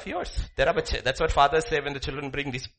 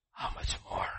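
soft whispered repeat; 9 dB quieter than usual. Real, not acted. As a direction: breathe it in a whisper. Preacher whispers rhetorical question.

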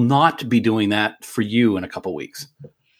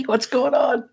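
squeaky silly greeting; slower than usual. Acted.

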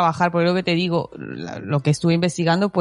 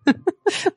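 high laugh; 12 semitones higher than usual. Real, not acted. Telling a funny family story, laughing high.

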